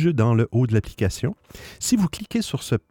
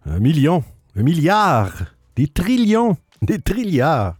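building excitement; 5 semitones higher, 3 dB louder than usual. Acted. Wishing huge casino winnings, escalating.

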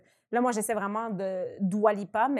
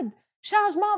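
higher, called across the field; mock coach call; 10 semitones higher, 3 dB louder than usual. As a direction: shout Aoud, changement to a player. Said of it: "arrêtait pas de crier". (appel d'une coéquipière au soccer, en criant)

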